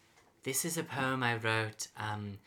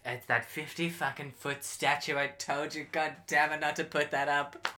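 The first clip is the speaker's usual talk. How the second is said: higher, louder exasperated rant; panicked blame; 5 semitones higher, 5 dB louder than usual. Acted. Spooky blaming the host for the statue, panicked and angry. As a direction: snap at him in panic.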